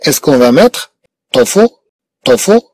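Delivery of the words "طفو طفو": high, mock-questioning wrong answer; wrong guess. Shown as a demonstration. Teacher testing pupils with a wrong word order, mock-doubtful and high. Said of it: "غير موجودة".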